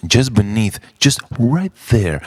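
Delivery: ordinary delivery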